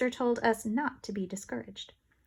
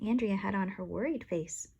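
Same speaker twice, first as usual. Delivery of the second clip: ordinary delivery, nothing marked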